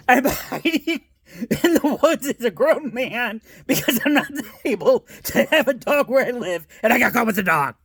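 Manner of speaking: real laughter while telling; laughing. Real, not acted. Laughing about hiding in woods.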